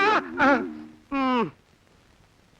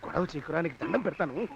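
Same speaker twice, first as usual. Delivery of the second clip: low, much softer plea; hushed pleading; 11 semitones lower, 8 dB quieter than usual. Acted. Master begging the troublemaker to hush, hushed. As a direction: beg him quietly not to make a scene. Begs the noisy man to hush.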